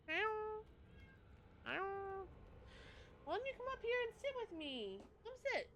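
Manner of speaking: very high, soft, quick coaxing. Real, not acted. Streamer coaxing her cat onto the chair in squeaky baby talk. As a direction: coax the cat in a tiny high voice.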